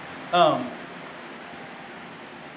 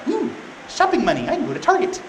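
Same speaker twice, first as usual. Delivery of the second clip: high-pitched eager little squeal of interest; mock delight; 9 semitones higher than usual. Delilah eyeing the payout and a shopping trip, mock delight. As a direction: act out a woman thrilled about shopping money.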